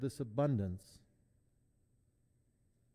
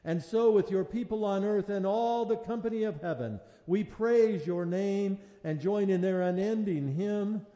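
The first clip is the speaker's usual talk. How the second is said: raised, solemn liturgical chant; liturgical intoning; 6 semitones higher, 7 dB louder than usual. Real, not acted. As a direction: intone it to the whole church. Chanted eucharistic prayer.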